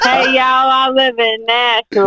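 high, slow mock Southern drawl; teasing imitation of a Southern twang. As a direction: mimic a Southern accent, drawn out. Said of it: "throw that Southern twang on it".

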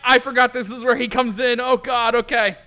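high, loud panicked realisation; real flustered yelp through the words; flustered outburst. Real, not acted. Flustered at forgetting character's entrance.

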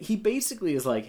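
usual speaking voice

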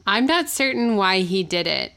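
usual speaking voice

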